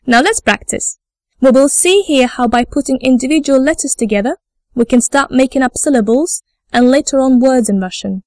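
ordinary delivery

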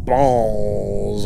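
BALLS drawn way out with heavy emphasis. Real, not acted. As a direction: lean long and slow on the word "balls". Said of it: "put so much emphasis on that word".

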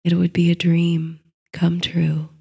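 usual speaking voice

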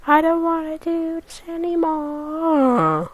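high, drawn-out whine, badly faked cracking; fake voice crack. Gamer pretending to give up, fake voice crack. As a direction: whine it slowly and let the voice break. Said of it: "That was a fake voice crack".

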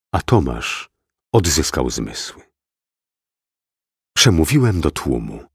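ordinary delivery

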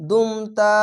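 drawn-out chanted recitation of দুমতা; Quran recitation. Shown as a demonstration. Teacher modelling the word for students, chanted slowly. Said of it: "দাল মিম পেশ দুম".

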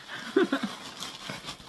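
breathy, voiceless little giggle; excited giggle. Real, not acted. Opening a friend's handmade gift, excited giggle. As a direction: giggle with anticipation.

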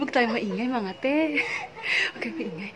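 softly, so as not to wake the sleeper; hushed. Real, not acted. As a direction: keep it down, someone's asleep. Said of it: "Ay, natutulog". (pabulong, huwag gisingin ang natutulog)

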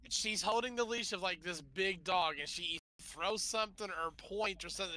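ordinary talk, nothing marked

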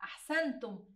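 ordinary delivery

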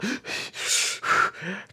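exaggerated voiceless panting; hammed-up struggle for air; mock breathless gasping. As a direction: imitate someone who claims they can't breathe. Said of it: "can't breathe".

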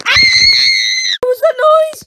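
loud, high startled cry; startled shout. Acted. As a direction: jump at the sudden noise. Startled by noise.

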